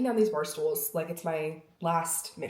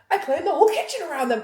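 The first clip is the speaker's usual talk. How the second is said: upset; 11 semitones higher, 7 dB louder, faster than usual. Retold or imitated. Upset reply to husband.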